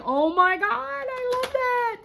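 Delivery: very high-pitched excited squeal; high, drawn-out fangirl gush; gushing delight. Retold or imitated. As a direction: squeal it, stretching the words out. Gushing to the artist.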